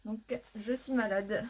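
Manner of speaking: ordinary delivery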